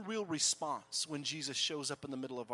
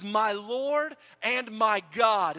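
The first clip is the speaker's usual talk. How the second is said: awed declaration; 5 semitones higher, 9 dB louder, much slower than usual. Acted. Thomas's awed worship.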